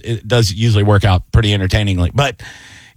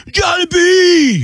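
high, drawn-out fan holler; imitated heckler yell; much slower than usual. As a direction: yell it slowly like a heckler from the back. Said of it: "keep, you know, yelling".

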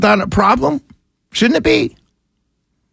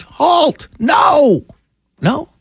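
a half-hammed shout of protest, pitched up; mock shout; 9 semitones higher, much slower than usual. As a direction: yell it like a pretend alarm call. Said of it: "we should all be screaming".

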